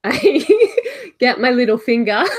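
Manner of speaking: giggling through the words; laughing. Real, not acted. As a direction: laugh while you say it. Laughing while describing action.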